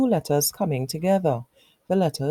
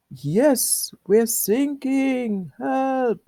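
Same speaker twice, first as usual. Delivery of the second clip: higher pitched, slow desperate cry; crying for help; 6 semitones higher, much slower than usual. Acted. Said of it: "they cried".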